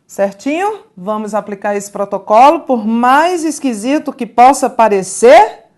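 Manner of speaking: normal talking voice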